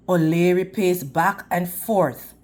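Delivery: usual speaking voice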